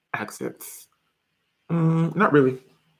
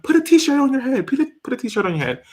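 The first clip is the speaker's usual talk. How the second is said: higher-pitched mock insistence; imitated nagging advice; 10 semitones higher than usual. Viewers always telling her about the T-shirt, mock insistent. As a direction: mimic pushy advice-givers. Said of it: "people are always like".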